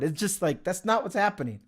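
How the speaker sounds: ordinary talk, nothing marked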